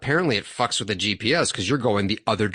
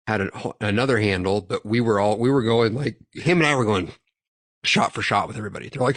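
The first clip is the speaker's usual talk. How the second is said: ordinary talk, nothing marked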